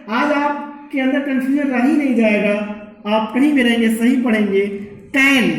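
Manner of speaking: ordinary talk, nothing marked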